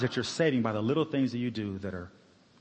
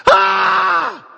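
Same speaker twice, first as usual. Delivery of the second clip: a blood-curdling scream of pain, very high and loud; a sudden high, loud cry of pain; piercing scream; 17 dB louder than usual. Retold or imitated. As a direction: let out the woman's agonised shriek. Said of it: "She was screaming out".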